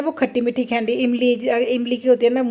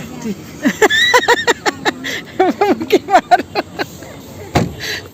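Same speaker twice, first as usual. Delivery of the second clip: a long high laugh that rises; 8 semitones higher than usual. Real, not acted. Laughing high with her family at the dhaba. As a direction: keep laughing high and free.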